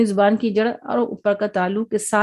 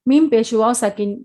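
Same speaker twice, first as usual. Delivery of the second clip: normal talking voice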